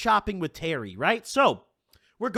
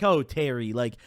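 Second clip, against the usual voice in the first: mock pity; 8 semitones lower than usual. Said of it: "like, sadness where it was like".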